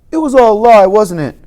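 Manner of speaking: loud, high accusing cry; accusing outburst. Retold or imitated. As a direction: throw the question at her, hurt and angry. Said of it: "I say".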